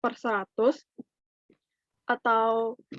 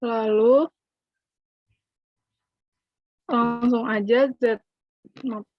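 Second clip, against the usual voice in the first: breaking up space radio; 4 dB louder than usual. Real, not acted. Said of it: "Kok sinyalnya putus-putus ya?".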